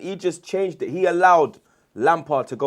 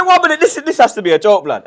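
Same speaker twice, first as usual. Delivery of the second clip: higher, laughing London street voice in disbelief; mock disbelief, London slang; 9 semitones higher, 9 dB louder than usual. Retelling his shock at the Makelele sale, mock London voice, higher and laughing. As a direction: play the stunned London lad, half laughing. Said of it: "you've done all the accents".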